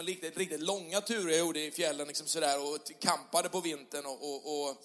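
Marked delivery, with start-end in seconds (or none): none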